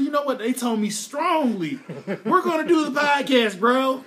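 hyped and laughing; excited. Retold or imitated. Retells own hyped self-talk.

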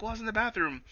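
high meek excuse; high mock voice. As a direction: squeak an excuse. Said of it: "she's like".

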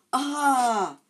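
loud high shocked yell; sharp alarmed shout; startled cry. A narrator's reading. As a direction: cry out as the branch hits. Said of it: "said Ron".

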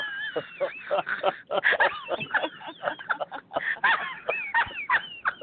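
a long burst of high, helpless laughter; long high-pitched cackle of laughter. Real, not acted. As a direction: crack up completely and keep laughing. Big laugh at threesome question.

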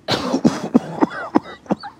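high, stuttering burst of laughter; villain cackle. Acted. Poodle boss laughs at the intruders, villain cackle. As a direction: let out a long villainous cackle. Villain drops her fake cute voice.

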